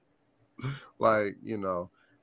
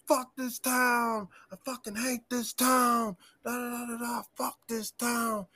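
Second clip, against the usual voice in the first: put-on slurred higher drunk complaint; drunk rant; 8 semitones higher, 4 dB quieter than usual. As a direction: imitate a drunk guy cursing the town. Drunk man ranting retold.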